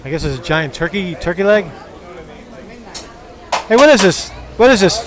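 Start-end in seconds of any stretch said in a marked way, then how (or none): 3.7-5.0 louder, higher call to a vendor; pitched-up shouted question. Real, not acted. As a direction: raise your voice to ask across a food stall. Calling out to food vendor.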